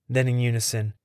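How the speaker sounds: plain reading voice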